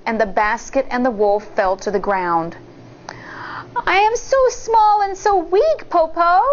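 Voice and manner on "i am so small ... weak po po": high, mock-feeble little girl; pretending weakness. A narrator's reading. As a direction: fake being too weak. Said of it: "Shang pretended".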